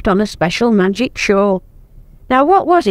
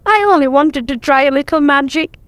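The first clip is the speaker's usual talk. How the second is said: crying; 6 semitones higher than usual. A narrator's reading.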